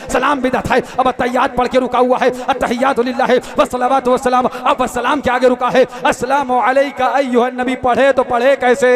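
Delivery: loud, measured declamation with stretched endings; full-force preaching. Real, not acted. Preacher narrates the man stuck in prayer, declaiming. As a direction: step through the story in a ringing chant.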